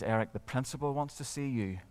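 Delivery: soft, hushed warning. Acted. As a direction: say it low, like trouble's coming. Friend passes an ominous message.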